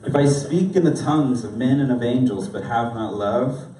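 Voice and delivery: measured, expressive reading with soft falling phrase ends; solemn recitation with deliberate pauses; scripture reading. Real, not acted. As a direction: read it reverently, letting key phrases drop quiet. I'm gonna read this.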